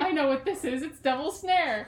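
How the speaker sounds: high, loud British accent with a laugh in it; playfully hammed-up British, no R in "Snare". Shown as a demonstration. Acting out Hermione's scene as an accent warm-up, British accent. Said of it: "get yourself into the accent".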